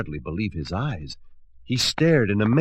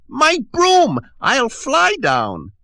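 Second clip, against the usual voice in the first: witch voice; 8 dB louder, slower than usual. Acted. Witch voiced, locked in tower.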